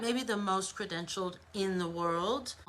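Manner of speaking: ordinary delivery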